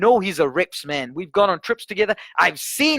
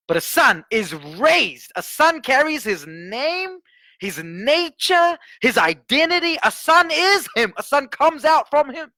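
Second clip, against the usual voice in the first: higher, slow, building preaching; preaching climax; 8 semitones higher, slower than usual. Acted. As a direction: rise into a fervent proclamation. Preaching crescendo.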